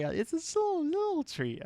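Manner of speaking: very high, tiny squeaky voice; squeaky cute voice. Real, not acted. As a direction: squeak it out like something adorable. Gushing over a cute movie.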